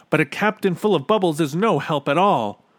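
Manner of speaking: put-on loud, high boy's voice; urgent Bartholomew. A narrator's reading. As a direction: say it emphatically in haste. Bartholomew hurries away from captain.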